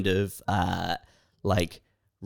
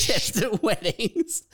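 high, drawn-out words through real laughter; laughing; 12 semitones higher, slower than usual. Real, not acted. Co-host cracking up at the beer spraying story, laughing. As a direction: squeeze the question out while cracking up.

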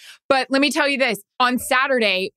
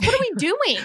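exasperated rant; 12 semitones higher than usual. Retold or imitated. Ranting at producer about cats.

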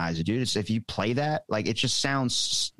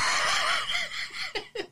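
high unvoiced laugh. Real, not acted. Laugh at Southern twang.

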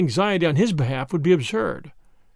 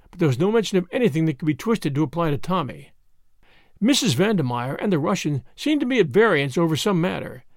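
plain reading voice

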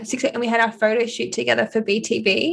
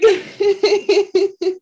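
loud laugh; 11 semitones higher, 5 dB louder than usual. Real, not acted. Laughing at impression.

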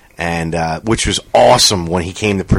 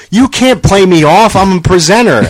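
mock indignant shout; 10 semitones higher, 6 dB louder than usual. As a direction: refuse to be played off by the orchestra. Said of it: "And he's like".

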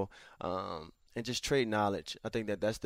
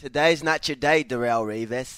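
lightly put-on British accent, louder and higher; 3 semitones higher, 9 dB louder than usual. Shown as a demonstration. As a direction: echo the taunt in an attempted British accent. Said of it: "Can you do a British accent".

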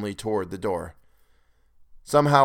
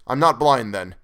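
louder, urgent call into the dark; 3 semitones higher, 6 dB louder than usual. Acted. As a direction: call out to find your companions. Denton calling out in blackness.

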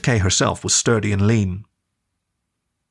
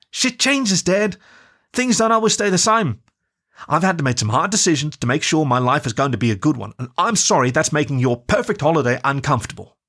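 put-on young woman pleading, higher and hurt; upset daughter; 6 semitones higher than usual. Daughter on a call pleading with her dad, higher and hurt. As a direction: push back at your father, close to tears.

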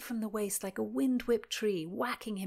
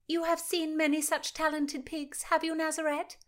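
teasing; 9 semitones higher, 4 dB louder than usual. Acted. Mary teasing Nazareth.

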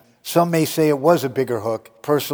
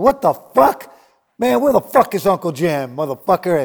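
higher and louder mock outburst; furious rant; 4 semitones higher, 4 dB louder than usual. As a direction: blow up like the promoter who lost it. Matt losing his mind, retold.

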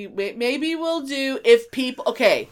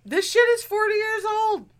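much higher and louder, exasperated; exclaimed; 12 semitones higher, 3 dB louder than usual. Real, not acted. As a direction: blurt it out in disbelief. Exasperated exclamation, then repeated flat.

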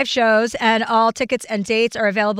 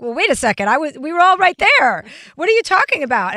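indignant outburst; 5 semitones higher, 4 dB louder than usual. Retold or imitated. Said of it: "And I'm like".

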